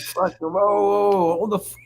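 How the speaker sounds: hammed-up backpedalling; mock startled protest. Startled reply to angry boss.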